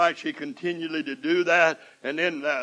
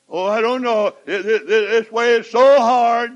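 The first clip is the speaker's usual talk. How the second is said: higher, louder whiny mock moan; whining complaint; 9 semitones higher, 13 dB louder than usual. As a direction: ham up a moaning believer. Preacher mimics complaining believer.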